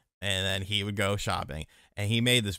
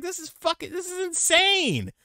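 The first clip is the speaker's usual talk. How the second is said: high mock disbelief. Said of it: "I was like".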